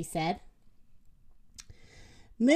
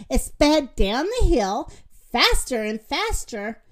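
plain reading voice

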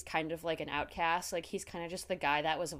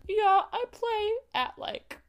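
higher, slow sheepish imitation; mock sheepish Eddie; 12 semitones higher, 4 dB louder, slower than usual. Mocking Eddie's little confession in a higher, slow sheepish voice. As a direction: play it small and embarrassed. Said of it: "Eddie being like".

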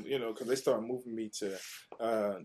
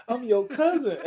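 even higher and louder, almost a yell; excited; 13 semitones higher, 10 dB louder than usual. Retold or imitated. Teen insisting to his NFL cousin, louder and higher. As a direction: push it up into an eager shout. Kid self meets famous cousin.

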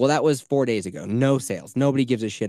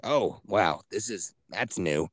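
hoarse voice; 6 semitones lower, 4 dB quieter, slower than usual. Retold or imitated. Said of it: "that's how I sounded".

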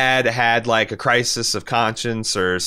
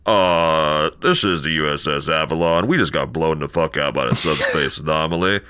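deadpan, lower captain on the radio; utterly unbothered radio call, a bit lower; calmest captain; 6 semitones lower than usual. Retold or imitated. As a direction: report a disaster like it's nothing. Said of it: "the calmest motherfucker in the galaxy".

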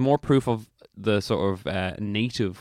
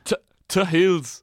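put-on Norfolk accent; 9 semitones higher, 4 dB louder, slower than usual. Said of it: "Do you know what a Norfolk accent sounds like?".

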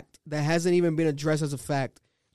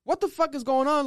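retold heated outburst; 9 semitones higher than usual. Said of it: "I was kinda heated".

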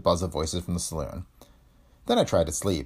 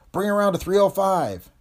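high, loud order down a tube; officer shouting the new course down the speaking tube; shouted order; 11 semitones higher, 4 dB louder than usual. Acted. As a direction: shout the course change so it carries below. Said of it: "I shouted down the speaking tube".

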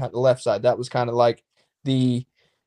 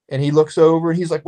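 ordinary delivery

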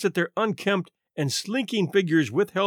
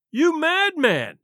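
high, drawn-out exclamation; appalled cry; 11 semitones higher, slower than usual. A narrator's reading. Tall man catches Murray's arm, crying out appalled. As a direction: cry it out slowly, pitched way up. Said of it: "cried the other".

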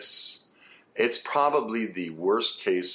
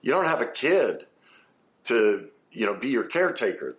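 ordinary talk, nothing marked

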